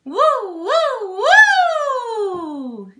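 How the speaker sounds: high sliding siren woo. Shown as a demonstration. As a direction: soar up and slide down like a siren. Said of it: "We're gonna go".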